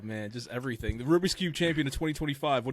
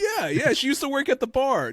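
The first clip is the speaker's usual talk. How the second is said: lightly voiced eager schoolgirl, pitched higher; mock girl voice; 12 semitones higher, 5 dB louder than usual. Retelling a classroom girl's answer in a higher mock voice. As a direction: toss it off high like a chirpy kid.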